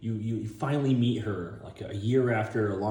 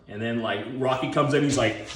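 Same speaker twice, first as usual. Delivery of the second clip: usual speaking voice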